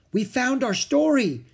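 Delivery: high, loud, thrilled cry; excited. A narrator's reading. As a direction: burst out with joy. Kids find the story.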